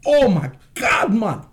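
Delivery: put-on high, laughing Caribbean accent; Caribbean accent impression. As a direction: mimic the island rapper cracking up in his Caribbean accent. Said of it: "He was like".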